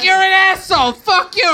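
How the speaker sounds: high, loud retold shouting; mock angry yell. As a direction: imitate the outraged lady at full pitch. Retold street quarrel, lady yelling.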